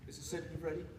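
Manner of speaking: low, soft undertone; hushed aside. Acted. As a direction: ask it discreetly under your voice. Secret aside to assistant.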